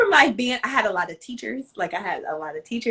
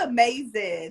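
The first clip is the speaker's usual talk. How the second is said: ordinary delivery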